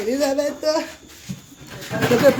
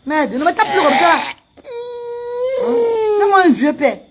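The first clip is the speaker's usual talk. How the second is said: real pain; 4 semitones higher than usual. Real, not acted. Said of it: "Ça fait mal".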